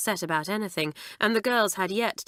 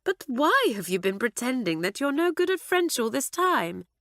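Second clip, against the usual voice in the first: perplexed; 7 semitones higher than usual. Acted. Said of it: "asked Carlotta, perplexed".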